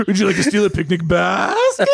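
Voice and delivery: lightly voiced Hanna-Barbera baddie, pitched high with a Californian lilt; a touch of a Yogi Bear bad guy; mock cartoon villain. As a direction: ham up a hint of a cartoon villain's offer. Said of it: "sounds like a bad guy in a Hanna-Barbera cartoon".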